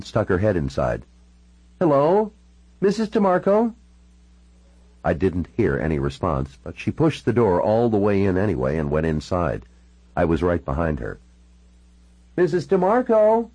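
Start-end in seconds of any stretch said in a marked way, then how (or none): 1.8-3.7 high, questioning call into a house. Acted. Calling into a house at the door. 12.3-13.5 calling out. Acted. Calling again inside the house.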